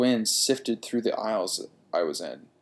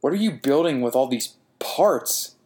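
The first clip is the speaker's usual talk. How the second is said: ordinary delivery, nothing marked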